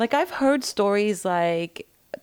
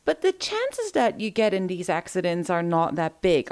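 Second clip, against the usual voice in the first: usual speaking voice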